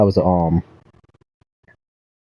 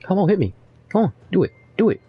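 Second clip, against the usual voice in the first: pitched-up goading; high taunting; 11 semitones higher, faster than usual. Real, not acted. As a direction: taunt the enemy. Taunting enemy in game.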